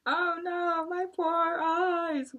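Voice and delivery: high, slow mock wail; hammed-up wail of pain. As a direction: tiger wailing over his stinging eyes. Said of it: "wailed Tiger".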